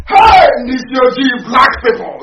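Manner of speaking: a loud, slow, crying shout of disbelief; exasperated outcry. Real, not acted. Speaker cries out at his listeners in disbelief, loud. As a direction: throw the name out like you can't believe them.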